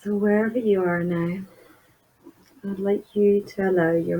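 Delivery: soft, unhurried meditation voice with long pauses; guided meditation. Acted. Therapist leading listeners through a body scan, soft guided meditation. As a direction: gently guide the listener inward, leaving space between phrases.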